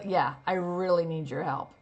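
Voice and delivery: sarcastic, not meaning a word. Shown as a demonstration. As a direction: sneer the request. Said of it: "What if you don't need their help at all?".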